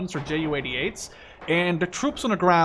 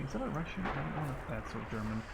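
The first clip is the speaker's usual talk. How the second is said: low murmur to himself while spotting an enemy; soft, wary aside under his breath; quiet muttering; 4 semitones lower, 13 dB quieter than usual. Real, not acted. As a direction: hush it, eyes on the screen. Spotting an enemy mid-game.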